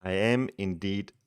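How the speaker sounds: ordinary talk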